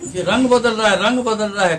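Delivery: high, loud astonished cry, repeated; excited crowd. Retold or imitated. Onlookers announce the statue changing colour, excited loud cry. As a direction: call it out as if seeing a miracle. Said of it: "इतने में वहाँ शोर मच गया कि".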